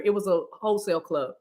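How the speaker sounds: normal talking voice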